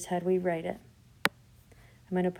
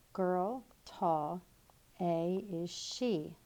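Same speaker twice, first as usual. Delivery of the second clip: slow scrambled words; much slower than usual. Shown as a demonstration. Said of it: "That doesn't make sense".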